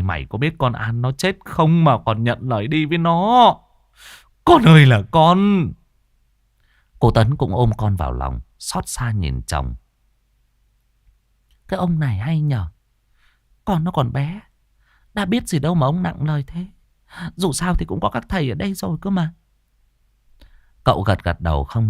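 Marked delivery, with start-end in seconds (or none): 0.0-5.7 distraught mother. Acted. Frightened mother scolds her child. 11.7-19.3 high-pitched wifely reproach; reproachful wife. Acted. Wife reproaches her husband.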